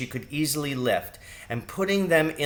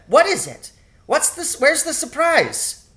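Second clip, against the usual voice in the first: put-on much higher, louder eager child voice; excited little girl; 14 semitones higher, 5 dB louder than usual. A narrator's reading. Young daughter eager for the surprise, excited high child voice. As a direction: squeal like an excited young mouse.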